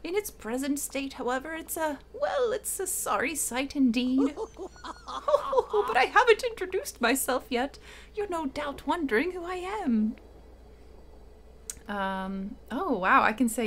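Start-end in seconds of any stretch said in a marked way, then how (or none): 0.0-4.3 put-on high, wavering old wizard; Merlin old man voice. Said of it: "my old man voice". 5.1-10.2 put-on high, wavering old wizard; Merlin old man voice. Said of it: "my old man voice".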